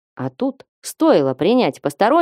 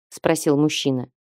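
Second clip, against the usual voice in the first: ordinary delivery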